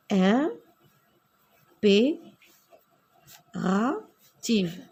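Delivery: mot étiré très lentement; étirée lentement. Shown as a demonstration. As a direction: articule chaque syllabe très lentement. (impérative détachée, très lente)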